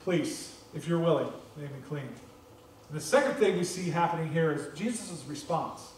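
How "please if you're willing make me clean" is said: hushed, humble plea fading to almost nothing; a quiet, humble plea fading out; soft plea. Pretend. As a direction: beg quietly, trailing off. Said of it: "he just says".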